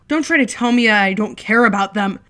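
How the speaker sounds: furious scream defending himself. Acted. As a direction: scream it with anger boiling over. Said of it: "Jack screams".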